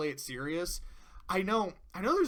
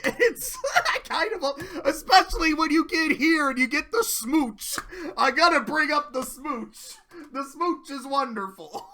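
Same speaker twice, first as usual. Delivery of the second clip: giddy laughing gush; 8 semitones higher, 6 dB louder than usual. Real, not acted. Gushing about the smooch, laughing.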